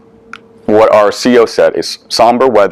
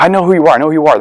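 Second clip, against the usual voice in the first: higher-voiced retold eager greeting; eager recognition; 6 semitones higher, much faster than usual. Mother meeting her son's friends for the first time, eager recognition. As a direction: jump in with instant recognition, pitched up.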